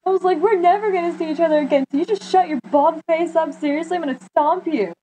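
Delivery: high-pitched indignant rant; retold angry freak-out. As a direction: retell telling off a kid in a high, worked-up voice. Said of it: "screaming at this kid".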